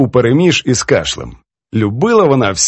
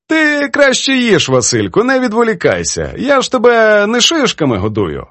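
put-on high-pitched, playful reproach from an elderly poetess; teasing old poetess; 9 semitones higher than usual. Poetess chides her young editor over his edits, teasing.

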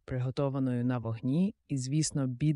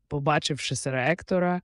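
ordinary delivery, nothing marked

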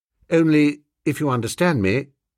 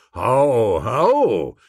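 like a comic-opera drinking song; drinking-chorus ho; 4 dB louder than usual. Acted. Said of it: "starting a drinking chorus".

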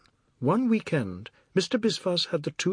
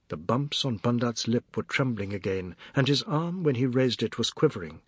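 plain reading voice

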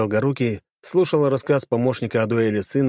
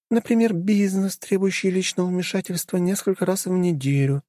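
voiced higher as a young woman; 10 semitones higher than usual.

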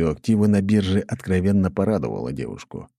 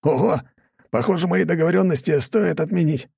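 excited; 11 semitones higher than usual. A narrator's reading. Guard pleased with quick sale.

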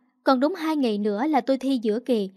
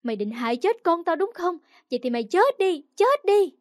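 high, angry tirade building to a shout; angry cursing; 6 semitones higher than usual. Acted. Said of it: "bên tai văng vẳng tiếng chửi rủa".

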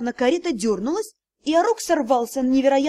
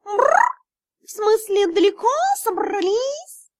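high, slow purring tomcat; cheeky cat; 8 semitones higher than usual. Acted. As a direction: play a smug big cat, drawn out. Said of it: "услышали наглое".

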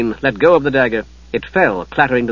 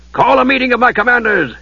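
higher, commanding voice; ordering; 10 semitones higher than usual. Acted. Lord ordering his aides while walking. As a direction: give the order briskly. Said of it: "he ordered".

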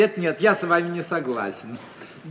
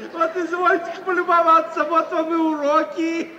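scornful indignation; 8 semitones higher than usual. Acted. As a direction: scoff at the fifty-year-old bride. Uncle indignant at aunt's marriage. (возмущённо-насмешливо)

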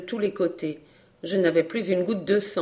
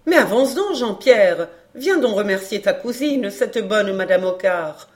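urging; 3 semitones higher, 6 dB louder than usual. Acted. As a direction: urge the boy forward with emphasis. Woman urges shy boy to thank cousin. (exhortation vive et sonore)

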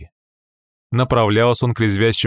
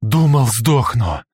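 exhausted groan; 2 semitones higher, 3 dB louder, much slower than usual. Acted. Stepping out of hot vehicle.

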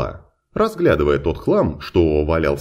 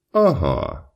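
ordinary delivery

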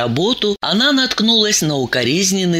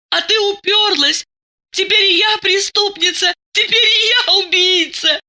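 high-pitched tearful outpouring throughout; hysterical confession. A narrator's reading. Masha explains her scheme to Diana, hysterical. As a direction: pour out guilt in a high, sobbing voice.

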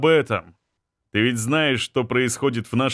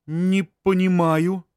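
woman's voice; 6 semitones higher than usual. Acted. Anzhela confused.

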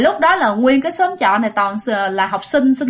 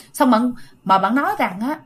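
ordinary talk

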